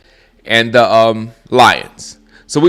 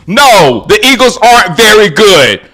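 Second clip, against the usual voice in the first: loud rant; 5 semitones higher, 5 dB louder than usual. Real, not acted. Ranting at Eagles fans.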